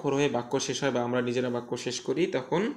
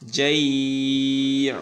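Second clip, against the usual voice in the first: জাই drawn out very slowly and louder; stretched; 5 dB louder, much slower than usual. Shown as a demonstration. Teacher showing the stop to pupils, long stretch. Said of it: "এক আলিফ টান হবে".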